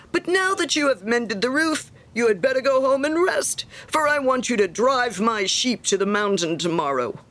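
high, emphatic start settling into a firm order; self-important miller. Acted. Miller ordering Hans to drive his sheep, bossy. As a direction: grant a favour, then demand one.

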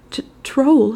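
high, stammering gasp of alarm; halting, high-pitched panicked report; faint stammer. A narrator's reading. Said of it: "he said at last, faintly".

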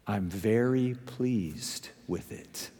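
low, slow, quietly proud; imitated self-satisfaction. Preacher quoting proud friend on his fire, self-satisfied. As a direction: savour it softly, pleased with yourself. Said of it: "hear the tone in his voice".

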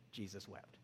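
dropped far down and soft, almost breathed; a sorrowful near-hush. Real, not acted. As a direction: let it fall to a hush. Quoting the shortest Bible verse.

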